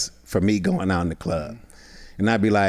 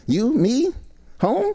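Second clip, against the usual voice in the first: much higher, a mock girl's come-on; 13 semitones higher, much slower than usual. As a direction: play the Chilean girl inviting him home. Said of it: "I would do my voice as the girl".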